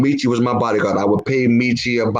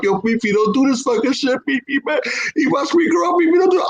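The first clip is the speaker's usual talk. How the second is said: pleading that climbs higher and higher; frightened pleading; 11 semitones higher than usual. Acted. Victim begs old friend to stop, pleading. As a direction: beg him in a rising, high voice. Victim begging in acted story.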